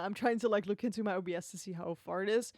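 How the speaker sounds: normal talking voice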